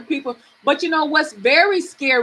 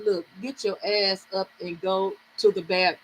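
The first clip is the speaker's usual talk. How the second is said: low, soft self-command; talking to herself; 6 semitones lower, 8 dB quieter than usual. Retold or imitated. Woman ordering herself up to the bathroom, low self-talk. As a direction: quietly order yourself to get moving. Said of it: "I had to talk to myself".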